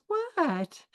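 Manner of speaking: a high, soft, innocent what. Retold or imitated. Daughter turns to her mother feigning innocence. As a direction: play dumb with a light what. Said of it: "she turns around and she goes".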